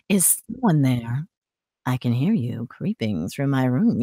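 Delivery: low, soft, rich voice; slow hushed question, pitched deep and gentle; Ballora. Acted. Animatronic hearing someone creeping through her room, low soft voice. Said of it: "low and rich voice".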